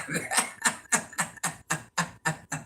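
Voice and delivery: a soft, unvoiced laugh under the breath; breathy laugh. Real, not acted. Mother laughing softly after telling her son, breathy laugh. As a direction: let it out quietly through the nose.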